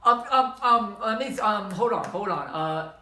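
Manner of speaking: loud, stammered string of ums; flustered stalling. Acted. Husband asked if he cheated on his wife, flustered stalling. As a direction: stall in a mock panic, buying time. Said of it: "that might be significant".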